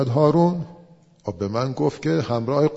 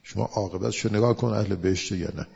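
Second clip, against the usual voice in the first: ordinary talk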